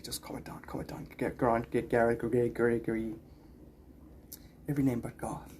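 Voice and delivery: hushed, whispered mock teacher scolding; imitating the headmaster. Headmaster hushing the unruly boy in class, whispered mock scolding. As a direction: mimic a weary teacher hushing a pupil. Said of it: "he's like".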